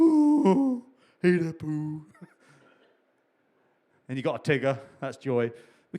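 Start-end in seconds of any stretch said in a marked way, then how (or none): 0.0-2.0 lightly voiced Winnie the Pooh, high; playful hint of Pooh. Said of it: "That's my Pooh impression there".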